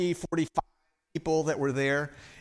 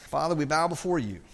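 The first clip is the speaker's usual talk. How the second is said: closing prayer. Acted.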